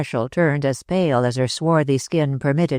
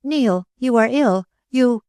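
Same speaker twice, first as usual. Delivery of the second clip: high alarmed cry; girl crying out as her lover turns ill, alarmed and high; 8 semitones higher than usual. A narrator's reading. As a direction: call out to him in sudden alarm. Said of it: "Suddenly Ruth cried".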